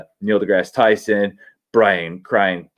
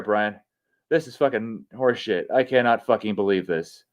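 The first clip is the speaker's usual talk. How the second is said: ordinary talk, nothing marked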